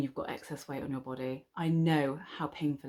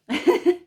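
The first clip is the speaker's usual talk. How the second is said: high burst of laughter; loud laugh; 11 dB louder than usual. Real, not acted. Vlogger laughing at her own bragging, loud laugh.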